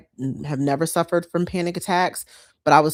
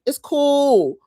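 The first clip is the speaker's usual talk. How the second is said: high, loud and easy-going; carefree it's cool, pitched up; breezy reassurance; 9 semitones higher, 9 dB louder, much slower than usual. Retold or imitated. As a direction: shrug it off brightly. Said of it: "I'd be like".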